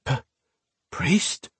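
high, breathless, stammered surprise; stunned gasp. Acted. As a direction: gasp it out in disbelief. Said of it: "Alesh gasped in surprise".

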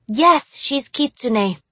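high-pitched exasperated woman. A narrator's reading. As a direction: cut in, fed up with the questions. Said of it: "came an exasperated female voice".

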